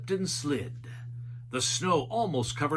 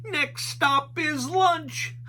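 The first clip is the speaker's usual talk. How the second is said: Shaggy voice; 12 semitones higher, slower than usual. A narrator's reading. Shaggy cheering about lunch.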